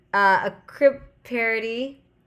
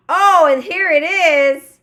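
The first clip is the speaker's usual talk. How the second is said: loud, high, slow excitement; excited; 9 semitones higher, 13 dB louder, slower than usual. Real, not acted. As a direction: announce it with delight. Finds the episode.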